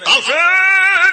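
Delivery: long, high wailed shout of a name; hammed-up mourner's cry; crying scream. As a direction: wail the name at full volume. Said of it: "dia berteriak".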